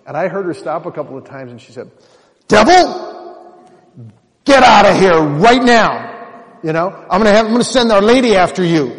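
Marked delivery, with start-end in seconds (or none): 2.5-8.9 high, loud, commanding rebuke; mom rebuking the devil. Retold or imitated. Speaker voicing her mother casting out the devil, loud and commanding. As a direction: order the devil out with full authority. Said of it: "that woman had authority".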